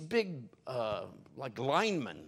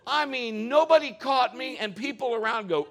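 excited; 12 semitones higher, 11 dB louder than usual. Retold or imitated. Still excited retelling.

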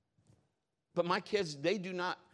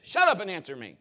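put-on a mom snapping at her kid, louder; mock-stern mother barking an order; loud mom demanding an answer; 4 semitones higher, 8 dB louder than usual. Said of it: "my mom would tell me this".

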